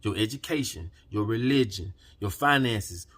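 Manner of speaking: ordinary talk, nothing marked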